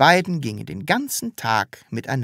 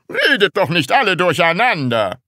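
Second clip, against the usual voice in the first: high, loud teacher's reprimand; shouting; 6 semitones higher, 6 dB louder than usual. A narrator's reading. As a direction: yell angrily over the chattering pupils. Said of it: "schrie Herr Groll". (laut schimpfend gebrüllt)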